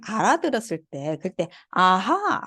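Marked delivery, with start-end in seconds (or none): 1.7-2.4 아하 bent down then up; bending aha. Shown as a demonstration. Teacher comparing a tone to understanding something, bending 아하. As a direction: bend the pitch through 아하 as if realizing. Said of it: "할 때 꺾어지잖아요".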